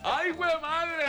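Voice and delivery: high, stretched-out cry of delight; amused exclamation. Real, not acted. Host reacting to a punchline, exclaiming high. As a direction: exclaim high and slow through laughter.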